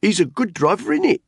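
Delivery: high, loud character voice; cab controller. A narrator's reading. Controller answering his driver's gossip, high loud retort. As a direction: pipe up loudly, pitched well up.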